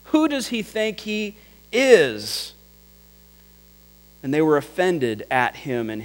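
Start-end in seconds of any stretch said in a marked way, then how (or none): 0.0-2.5 high, slow scoff; scoffing question. Retold or imitated. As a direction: sneer at his nerve. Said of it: "Imagine the talk".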